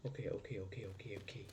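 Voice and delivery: very soft, low repeated words; hushed self-calming. Real, not acted. Player steadying nerves after attack, hushed and low. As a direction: talk yourself down under your breath.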